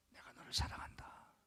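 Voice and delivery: soft unvoiced whisper; God whispering love to a weary believer. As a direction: breathe it like a secret from heaven.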